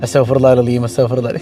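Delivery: low, murmured mother's istighfar after prayer; imitating mum's prayer. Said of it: "ഉമ്മച്ചി ഇങ്ങനെ". (ഉമ്മ ചൊല്ലുന്നതുപോലെ താഴ്ന്ന സ്വരത്തിൽ)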